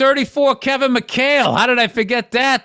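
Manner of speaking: high, loud sudden recall; excited. Real, not acted. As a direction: burst out remembering the player.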